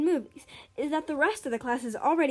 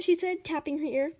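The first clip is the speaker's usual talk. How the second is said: ordinary delivery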